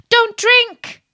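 high, loud cry of alarm; urgent princess shouting a warning; sudden cry. A narrator's reading. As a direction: cry out suddenly to stop him. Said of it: "suddenly cried out the little princess".

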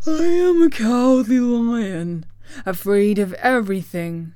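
big declaiming lion voice, swelling on key words; theatrical lion making his plea; Cowardly Lion. Acted. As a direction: plead grandly as a timid beast.

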